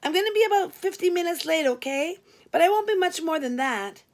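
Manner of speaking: breezy and sweet; amiable Glenda. Acted. Late receptionist cheerfully admitting it's her shift.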